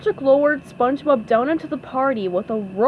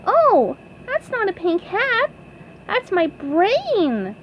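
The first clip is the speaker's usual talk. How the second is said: high squeaky correction; slow down on the reveal, pitched up; SpongeBob voice; 11 semitones higher than usual. A narrator's reading. SpongeBob explains to Patrick, high cartoon voice. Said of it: "said SpongeBob".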